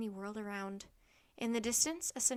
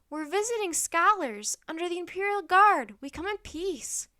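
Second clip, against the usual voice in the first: raised, loud proclamation; yelled plea; 6 semitones higher, 10 dB louder than usual. A narrator's reading. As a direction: declare peace to armed riders. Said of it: "Jack yelled".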